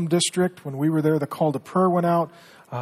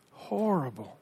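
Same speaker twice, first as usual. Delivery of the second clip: softer, breathy-free hushed horrible; hushed grief; 2 semitones higher, 5 dB quieter, slower than usual. Real, not acted. Repeats horrible, quieter.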